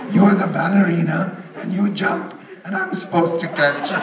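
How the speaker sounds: laughing. Retold or imitated. Punchline quote to Lou Kahn.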